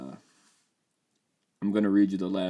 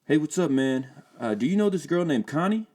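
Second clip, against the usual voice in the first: higher, louder friendly street voice; lightly voiced friend; 8 semitones higher, 5 dB louder than usual. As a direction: hail a stranger casually.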